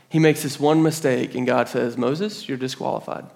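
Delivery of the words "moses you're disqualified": quiet, grave pronouncement; solemn verdict. Acted. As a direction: deliver the sentence softly. God pronounces judgement on Moses.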